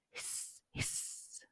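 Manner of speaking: unvoiced soft hiss; quiet snake hissing. A narrator's reading.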